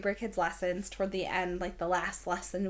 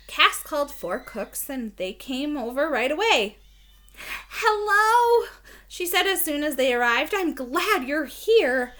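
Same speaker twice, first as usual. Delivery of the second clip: louder, lively read-aloud; reading to children; 3 semitones higher, 6 dB louder than usual. Acted. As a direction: read it out brightly for the class.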